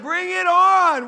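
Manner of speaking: loud, high, drawn-out challenge; cocky taunt. Acted. Servant talking trash to the Syrians, cocky shout. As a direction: bellow a mock battle challenge.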